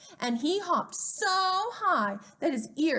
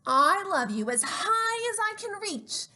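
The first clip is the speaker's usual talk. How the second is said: high, straining little voice; little hare; 9 semitones higher, 4 dB louder than usual. Acted. Little hare tells his father, high and eager. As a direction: reach up with the words. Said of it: "said Little Nut Brown Hare".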